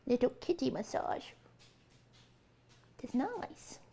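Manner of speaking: lightly voiced baby talk for the cat; cute animal voice. Owner cooing to cat Coots in a lightly put-on cute voice. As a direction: coo a little pet voice at the kitty. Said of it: "doing my animal voice".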